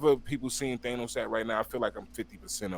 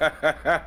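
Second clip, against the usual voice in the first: loud, higher-pitched laugh; real laugh; 6 semitones higher, 8 dB louder than usual. Real, not acted. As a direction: burst out laughing. Amused at the lyric guess.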